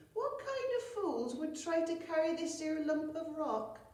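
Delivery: high country-woman voice; high rustic dame; Carty's voice. Acted.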